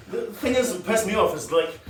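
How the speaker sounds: ordinary talk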